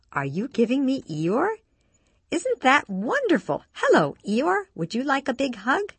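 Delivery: put-on high, sing-song voice for a toddler; doting baby-talk. A narrator's reading. Ginny takes the toy from the toddler, high-pitched baby-talk. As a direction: coo with lifted, swooping delight.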